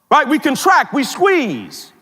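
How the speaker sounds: ordinary delivery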